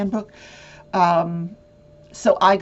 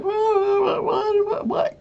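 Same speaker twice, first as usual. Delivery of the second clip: high, cracking repeated what; mock exasperation; 13 semitones higher, much slower than usual. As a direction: sputter in frustrated disbelief, high. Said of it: "and I'm like".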